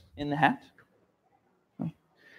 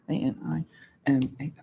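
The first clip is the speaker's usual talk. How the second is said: A N I M A spelled letter by letter; 4 semitones lower, 10 dB quieter, much faster than usual. Shown as a demonstration. Teacher spelling animal for the class letter by letter. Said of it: "spelling out animal".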